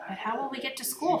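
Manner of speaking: put-on high, pouty little-kid question; pouting. A narrator's reading. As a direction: ask it with a child's pout.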